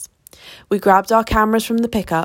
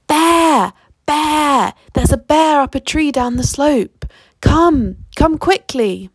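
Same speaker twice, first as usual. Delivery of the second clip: high, drawn-out excited calls; breathless news of a sighting, called out high; great excitement; 7 semitones higher, 4 dB louder, slower than usual. A narrator's reading. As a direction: call everyone over urgently, voice raised high. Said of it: "showing great excitement".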